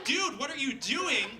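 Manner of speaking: high, loud, drawn-out protest; mock exasperated shout after someone; retold shouting. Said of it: "And I'm shouting at him".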